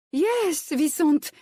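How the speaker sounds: flustered high recovery; quickly covering a slip, caught off guard. Acted. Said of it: "she recovered, flustered".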